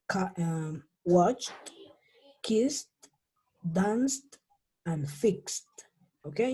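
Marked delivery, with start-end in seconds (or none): 1.0-5.7 "watched, kissed, danced, fixed" each one syllable ending in T; one at a time, T closing "watched" through "fixed"; final T sound. Shown as a demonstration. Teacher models example list, "watched" to "fixed" ending in T. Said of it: "se va a pronunciar como si fuera una".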